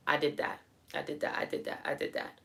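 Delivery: fading smug murmur into a whisper; proud self-affirmation. Pretend. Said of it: "and you going".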